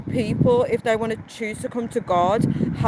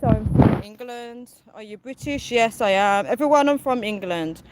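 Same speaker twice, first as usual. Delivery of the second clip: ordinary talk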